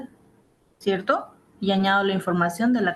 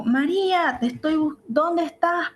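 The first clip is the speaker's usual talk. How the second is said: agudo, llamando a María a gritos suaves; llamado con angustia; 8 semitones higher than usual. Retold or imitated. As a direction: busca a alguien con urgencia. (madre que busca a María)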